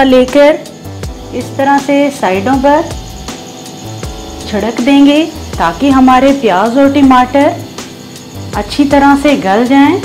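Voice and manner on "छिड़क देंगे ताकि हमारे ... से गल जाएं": usual speaking voice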